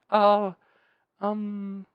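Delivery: imitating a thrown-off clerk's pause; stunned hesitation. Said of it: "he literally pauses and is like".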